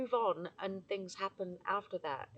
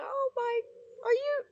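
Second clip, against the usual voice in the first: much higher, louder, voice breaking into tears; crying; 4 dB louder than usual. Real, not acted. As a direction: really cry through it. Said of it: "start crying again".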